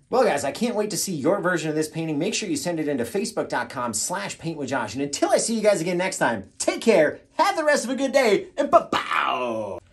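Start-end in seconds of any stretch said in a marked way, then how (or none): 5.0-9.8 hyped sign-off. Acted. Host signing off to the painting show's viewers, hyped up. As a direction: belt out a showman's goodbye.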